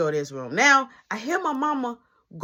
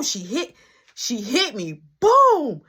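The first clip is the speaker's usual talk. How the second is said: ordinary talk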